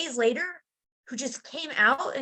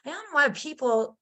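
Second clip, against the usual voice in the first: ordinary talk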